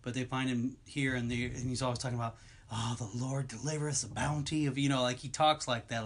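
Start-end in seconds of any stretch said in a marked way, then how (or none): 2.7-4.8 lightly voiced southern preacher; a hint of a southern preacher blessing. The movie cannibal giving thanks, lightly voiced southern preacher. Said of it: "like a southern preacher".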